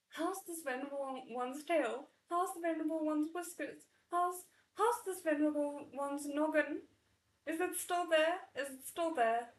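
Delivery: frantic whine of a tiny dragon; lightly voiced dragon in a fluster; panicked little dragon. A narrator's reading. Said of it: "The dragon wailed in terror".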